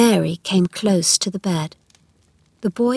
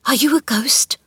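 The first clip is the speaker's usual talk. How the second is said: higher, whispered frightened question; scared boy's hushed question; half-frightened whisper; 5 semitones higher than usual. A narrator's reading. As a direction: whisper it, half afraid of the answer. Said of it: "he said in a half-frightened whisper".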